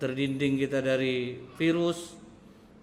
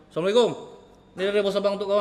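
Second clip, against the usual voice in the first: loud, curt greeting from an irritated husband; husband snapping at his wife over the envelope, loud and curt; grumpy husband; 3 semitones higher, 5 dB louder, much faster than usual. Retold or imitated. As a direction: toss the words at her, fed up. Annoyed husband tosses envelope.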